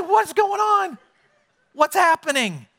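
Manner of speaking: high shout of confusion; alarmed call. Retold or imitated. Older brother shouting toward the noisy house, alarmed. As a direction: call out over the party noise, startled. Brother hears party, calls out.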